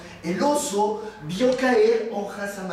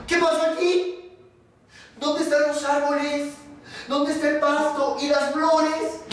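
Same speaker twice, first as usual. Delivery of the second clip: high-pitched dismayed questions; astonished bear; 7 semitones higher than usual. Acted. Bear finding the forest turned into factory, high astonishment. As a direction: cry out in disbelief, pitched well up.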